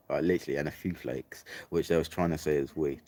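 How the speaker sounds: ordinary delivery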